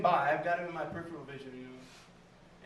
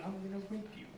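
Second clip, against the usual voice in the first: much softer throwaway comeback; quiet mock threat; 2 semitones higher, 10 dB quieter, faster than usual. Shown as a demonstration. Comic advising the audience what to say back, quiet mock threat. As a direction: drop the volume right down for the threat.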